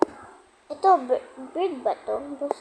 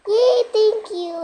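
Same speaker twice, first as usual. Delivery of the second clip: high, loud, drawn-out kiddie cheer; pretend happy baby; 7 semitones higher, 8 dB louder, slower than usual. Baby cheering at parents' permission. (masayang boses-bata, mataas at mahaba)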